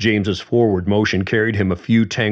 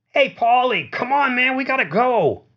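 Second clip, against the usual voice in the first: much higher, called out from far off; calling from down the block. Acted. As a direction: call down the street to a friend. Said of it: "A voice bounced from down the block".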